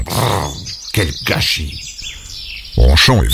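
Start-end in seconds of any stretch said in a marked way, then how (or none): 0.0-1.8 soft, low grumble under the breath; grumbling mutter. Acted. Léon turning his back on his pig, muttering softly. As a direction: growl it to yourself. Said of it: "en marmonnant".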